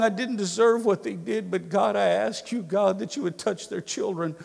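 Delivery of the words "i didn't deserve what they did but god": prayer. Shown as a demonstration. Preacher voicing a hard prayer.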